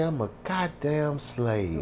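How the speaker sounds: slow, soft crushed realisation; heartbroken. Retold or imitated. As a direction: let it sink in quietly, half-disbelieving. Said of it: "I was heartbroken".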